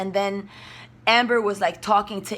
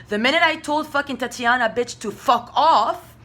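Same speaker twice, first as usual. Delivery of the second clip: ordinary talk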